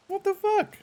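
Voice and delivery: very high squeaky disbelief; outraged squeak. Real, not acted. Streamer finds her lemongrass dead, squeaky outrage. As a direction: shriek it in tiny outraged disbelief.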